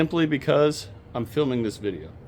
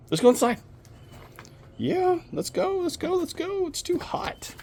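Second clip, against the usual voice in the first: high, breathy voice for the dog; pet talk; 4 dB quieter than usual. Real, not acted. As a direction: coax the dog inside in a tiny high voice. Coaxing the dog baby Joe inside.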